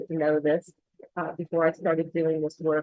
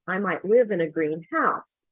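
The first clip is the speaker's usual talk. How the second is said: greenhouse as two words, green HOUSE; stress on house. Shown as a demonstration. Teacher giving an example of a home, greenhouse stressed on HOUSE. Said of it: "second syllable stress".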